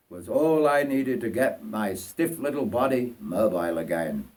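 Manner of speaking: usual speaking voice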